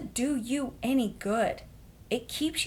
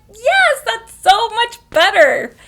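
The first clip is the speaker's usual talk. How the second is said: much higher, loud delighted agreement; 13 semitones higher, 13 dB louder, slower than usual. Retold or imitated. Agreeing to rename the character Peg, delighted. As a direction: agree with relieved glee. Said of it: "I'm like".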